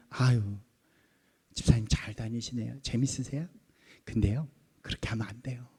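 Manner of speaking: low, soft, polite meddling; mild nagging. Acted. Longtime churchgoer sidling up to a new deacon, softly nagging. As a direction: gently start correcting someone, keeping it low and quiet. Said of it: "막 그렇게 잔소리를 하는 거예요".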